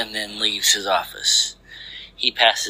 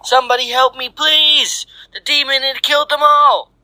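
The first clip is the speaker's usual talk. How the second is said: very high, louder panicked cry for help; terrified screaming; 5 dB louder than usual. A narrator's reading. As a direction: scream desperately into the walkie-talkie. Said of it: "He starts screaming".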